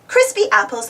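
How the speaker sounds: plain reading voice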